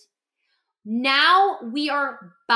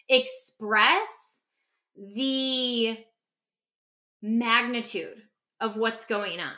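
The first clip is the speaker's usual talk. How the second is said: ordinary talk, nothing marked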